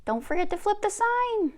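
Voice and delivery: high, carrying holler. Acted. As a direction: call it after them. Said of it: "Nukamis hollers at the both of them".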